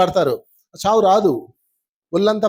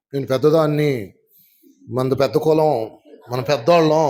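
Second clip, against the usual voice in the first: lower, haughty mock boast; proud egoist; 7 semitones lower than usual. Mocking the egoist who cannot laugh, in a low haughty voice. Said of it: "ఇలా ఉంటాడు".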